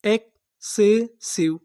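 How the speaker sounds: syllables एक से शिव stretched apart; slow blending. Shown as a demonstration. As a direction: sound out एक से शिव slowly, piece by piece. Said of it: "पूरी meaning क्या बनी?".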